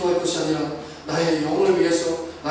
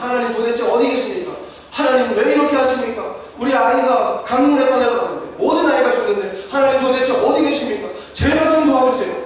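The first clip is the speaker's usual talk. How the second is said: higher, louder anguished crying-out prayer to God; desperate plea; 4 semitones higher, 3 dB louder than usual. Acted. Preacher voicing Moses' mother begging God for help, anguished. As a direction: plead like a mother losing her child.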